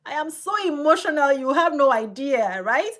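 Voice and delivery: high, loud insistence; emphatic protest. Real, not acted. Protesting to the host that she is very emotional, high and loud. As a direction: burst out high and loud to deny being cold.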